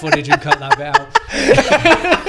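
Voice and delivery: laughing hard, high and loud through the words; can barely get it out for laughing. Real, not acted. Joking to his co-host about cutting footage, laughing loudly.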